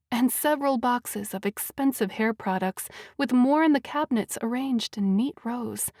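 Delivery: plain reading voice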